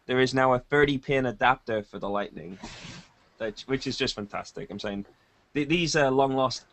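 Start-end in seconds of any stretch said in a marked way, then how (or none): none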